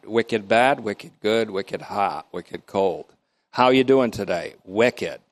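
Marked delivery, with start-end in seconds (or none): 0.0-3.0 lightly done Vermont accent, wicked said low and easy; a hint of Vermont on each wicked. Shown as a demonstration. Teacher showing how Vermonters use wicked, light Vermont accent. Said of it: "I get the accent right back". 4.6-5.2 lightly done Vermont accent, wicked said low and easy; a hint of Vermont on each wicked. Shown as a demonstration. Teacher showing how Vermonters use wicked, light Vermont accent. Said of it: "I get the accent right back".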